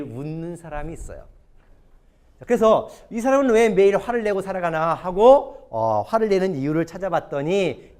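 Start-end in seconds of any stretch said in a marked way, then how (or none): none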